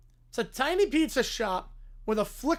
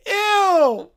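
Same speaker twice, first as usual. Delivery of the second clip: loud, high cry of disgust; 8 semitones higher, 11 dB louder than usual. Acted. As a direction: recoil with a drawn-out "Ew". Character disgusted by bloody sauce.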